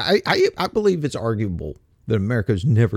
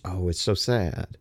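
mock sadness; 5 semitones lower, 6 dB quieter than usual. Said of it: "They're just".

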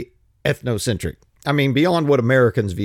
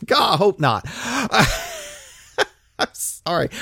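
laughing; 7 semitones higher than usual. Real, not acted. Reacting to listener's claim.

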